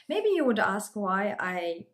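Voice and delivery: ordinary talk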